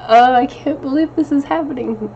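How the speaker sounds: mock shock at her own emotional week; mock disbelief. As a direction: play up your own silly shock.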